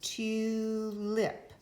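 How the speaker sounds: Tulip said TU, pause, LIP, with a long U; slow teacher demo of Tulip=; split into syllables. Shown as a demonstration. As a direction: break Tulip after the TU. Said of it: "Did you hear it break here?".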